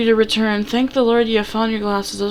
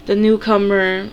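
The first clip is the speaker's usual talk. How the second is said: usual speaking voice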